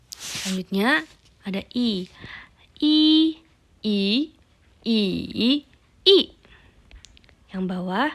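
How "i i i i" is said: "i" high level, rising, dipping, then falling; four tones in turn. Shown as a demonstration. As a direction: run "i" through four pitch contours. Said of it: "jadi nadanya".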